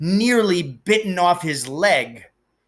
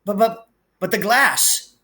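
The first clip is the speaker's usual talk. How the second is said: stammering shock; 6 semitones higher than usual. Acted. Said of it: "he kept saying".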